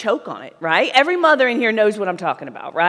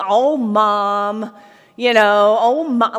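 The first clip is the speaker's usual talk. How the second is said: put-on louder, whining child protesting; whiny kid; 5 dB louder, much slower than usual. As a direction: kid whining at mom to stop nagging.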